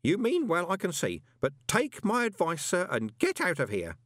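high, urgent character voice; earnest old underling. Acted. Grote warns Moist to leave, earnest and high-pitched. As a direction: plead a warning to the boss.

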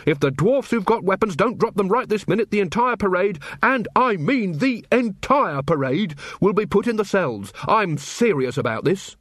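angry officer threatening a whole parade with the cells; stern warning. Acted. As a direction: lay down the law to the parade. Carrot furiously ordering the dwarfs.

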